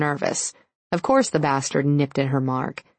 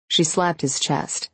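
usual speaking voice

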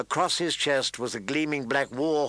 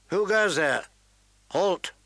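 sentry calling out a challenge; called-out challenge; 6 semitones higher than usual. A narrator's reading. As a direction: shout a halt across the ice. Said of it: "called out a challenge".

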